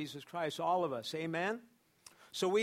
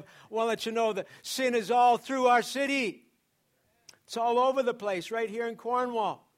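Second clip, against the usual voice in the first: rising, louder preacher's cry; preaching; 4 semitones higher, 7 dB louder than usual. Acted. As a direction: preach it out to the church, building. Preaching at full force.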